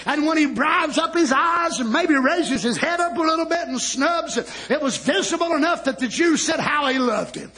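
high, driving sermon delivery; full-force preaching. Acted. Preacher retelling Lazarus' raising to the congregation, fervent preaching. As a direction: preach it at full pitch without letting up.

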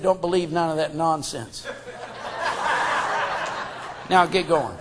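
low, soft nose-to-nose retelling; quiet, low mock warning; confidential warning. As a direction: imitate a hushed warning, close up. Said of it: "got face to face, nose to nose".

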